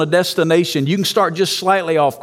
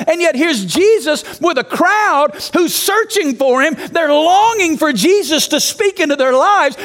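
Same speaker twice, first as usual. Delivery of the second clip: preaching at full force; 8 semitones higher than usual. Acted. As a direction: rouse the room like a revival preacher. Fired-up preaching to church planners.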